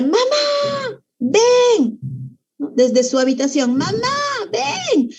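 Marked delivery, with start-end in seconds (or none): 0.0-2.0 high, drawn-out call for mom; long, high-held cry on each word; child calling out. Acted. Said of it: "empieza a gritar". 3.8-5.1 very high, stretched-out call; slow, high-pitched mock yell; desperate child calling. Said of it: "Y grita tan desesperadamente".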